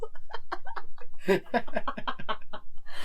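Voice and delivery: airless laugh on a rising pitch; real laugh at own joke; breathy laugh. Real, not acted. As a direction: let a silent wheeze of laughter out. Laughing after snubbing Han Solo.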